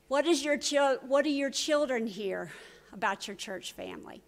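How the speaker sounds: usual speaking voice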